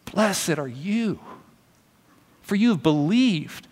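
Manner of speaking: slow, hushed scripture read. Acted. Pastor reading the verse to the congregation, slow and hushed. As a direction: lay the verse down gently.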